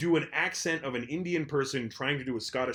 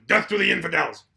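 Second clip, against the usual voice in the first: skeleton puppet; 5 semitones higher, 7 dB louder than usual. Retold or imitated. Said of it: "here's your skeleton puppet".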